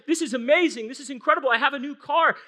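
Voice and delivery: high, loud mock thrill over a new car; excited thanks. Preacher acting out a churchgoer thrilled by a free car, excited. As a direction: gush like someone handed free car keys. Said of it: "You might say".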